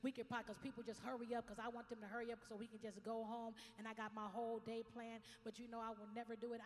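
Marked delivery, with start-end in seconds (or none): none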